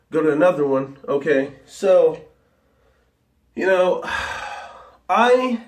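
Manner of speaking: ordinary talk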